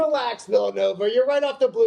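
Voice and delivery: loud and high, mock outrage; mock scolding shout. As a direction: yell at him in mock disgust.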